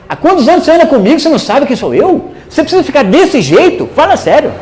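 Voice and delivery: high-pitched retold telling-off; incredulous scolding. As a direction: scold in disbelief, pitched well up. Acting out Jesus's incredulous scolding.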